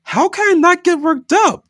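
very high, loud outcry; crying out. Acted. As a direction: cry out in distress. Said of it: "Dina cried".

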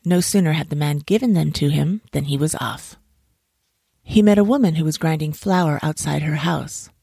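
ordinary delivery

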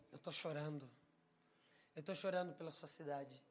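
soft, low, grave voice; understated sorrow. Retold or imitated. Holy Spirit weeping for his city, understated sorrow. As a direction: confide grief quietly, without sobbing.

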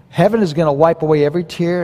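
ordinary delivery

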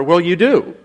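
normal talking voice

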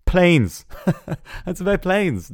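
high and loud, laughing in disbelief; high laughing scoff at a spin-off named Planes; laughing mockery. Real, not acted. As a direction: laugh out the obvious title. Mocking the spin-off title Planes.

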